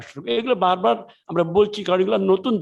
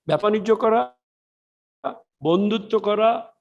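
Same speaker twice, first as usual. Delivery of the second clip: normal talking voice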